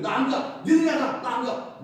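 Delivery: loud high protesting shouts; mock complaining yell. Preacher voicing a grumbler who refuses to march, loud yell. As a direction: throw a childish tantrum, refusing to go. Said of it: "뭐 이래버리면요".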